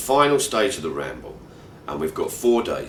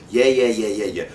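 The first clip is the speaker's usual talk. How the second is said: dismissive yeah yeah; 2 semitones higher, 7 dB louder than usual. Retold or imitated. Narrator cutting Eunice short, impatient and dismissive. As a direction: rattle them off to shut the topic down.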